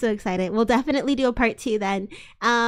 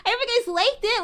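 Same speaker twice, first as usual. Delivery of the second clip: hammed-up hope; 9 semitones higher, faster than usual.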